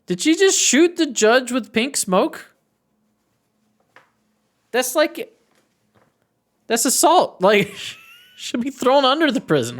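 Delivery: high-pitched incredulous reaction; astonished disbelief. Real, not acted. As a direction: voice shooting up in shock. Player reacts to smoke gun shot.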